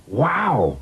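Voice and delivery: put-on high, awed child's exclamation; very excited. A narrator's reading. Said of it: "Robin was very excited".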